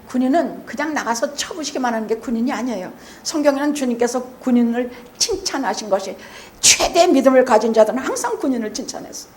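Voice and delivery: normal talking voice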